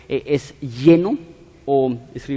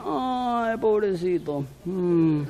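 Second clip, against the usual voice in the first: high, soft cooing pity; hollow, high cooing sympathy; mock pity; 3 semitones higher, 6 dB quieter, much slower than usual. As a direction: coo it like a passerby pitying a dog. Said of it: "y dicen".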